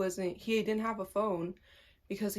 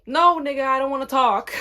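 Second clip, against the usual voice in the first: loud, higher-pitched snap back; retold angry refusal; 5 semitones higher, 10 dB louder than usual. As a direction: shout him down, half laughing.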